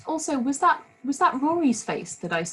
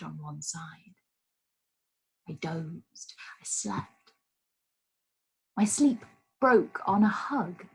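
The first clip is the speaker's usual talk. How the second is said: poem reading; 3 semitones lower than usual. Acted. Reads a poem aloud.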